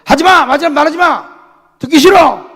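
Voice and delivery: high, loud furious refusal; angry pastor. Retold or imitated. Pastor friend yelling at his brother, refusing advice. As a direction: shout him down, refusing to hear. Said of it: "형, 성령이 하시는 말씀을 들어".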